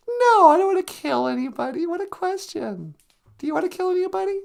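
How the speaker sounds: puppet voice. Acted. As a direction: squeak it out like a cheeky puppet.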